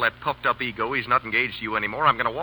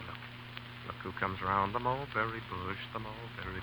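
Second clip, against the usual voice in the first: hushed warning; 3 semitones lower, 15 dB quieter than usual. Acted. Spotting Gerald, lowers voice secretly.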